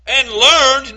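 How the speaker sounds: "learned" hit hard and loud; stress on learned. Real, not acted. Preacher continuing the verse for listeners, "learned" emphasized. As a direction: punch out the word "learned". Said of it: "now emphasize that word learned".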